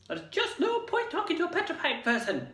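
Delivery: high, prim nurse voice; hospital matron refusing to humour visitors; fussy matron. Acted. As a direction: tut at them like a weary nurse.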